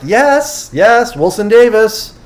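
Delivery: high, slow, excited agreement; eager yes. Retold or imitated. Host cheering on the speaker's physics questions, excited. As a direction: yes yes, thrilled he's said it. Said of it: "And I'm like".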